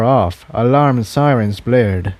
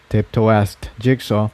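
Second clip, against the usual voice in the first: ordinary delivery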